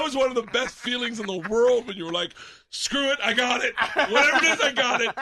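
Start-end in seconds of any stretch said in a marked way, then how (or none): 2.7-5.1 high, loud devil-may-care outburst; cocky, pitched-up exclamation; reckless bravado. Retold or imitated. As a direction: throw caution away, loud and high. Acts out reckless thrill.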